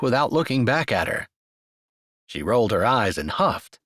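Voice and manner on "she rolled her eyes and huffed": usual speaking voice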